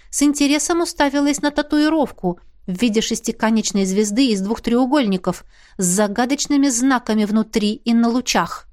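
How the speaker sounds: usual speaking voice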